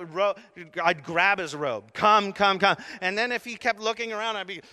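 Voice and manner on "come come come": urgent beckon. Retold or imitated. Pastor imagines pulling Jesus along.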